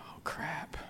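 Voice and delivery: a dismayed whisper, no voice; whispered oh crap. Retold or imitated. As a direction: breathe it out in alarm. Son realizes mother is serious.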